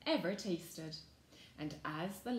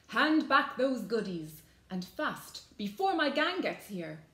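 stern demand; 3 semitones higher, 7 dB louder than usual. A narrator's reading. Granny orders robbers to surrender.

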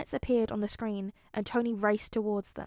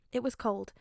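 plain reading voice

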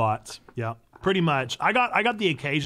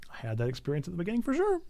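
"I had that experience at the beginning for sure" muttered softer and quicker; quiet fast aside; 3 semitones higher, 7 dB quieter, faster than usual. Real, not acted. Agreeing with chat again, quick and quiet. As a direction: toss the repeat off under the breath.

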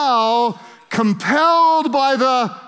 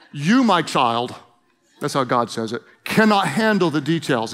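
loving God voice; 6 semitones lower than usual. Shown as a demonstration. Preacher showing how God answers his child, low and tender. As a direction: say it like a gentle father. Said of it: "He's much more loving about it".

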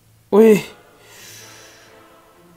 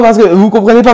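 usual speaking voice

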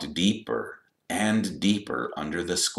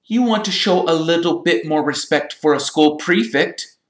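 high, loud pompous scolding; outraged; 11 semitones higher, 6 dB louder than usual. A narrator's reading. Percy demanding respect from Malfoy, outraged. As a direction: demand respect with raised, indignant voice.

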